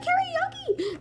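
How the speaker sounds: high, squealed karaoke cry, held back; mock scream. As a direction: imitate screaming beside a sleeper. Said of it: "you could scream".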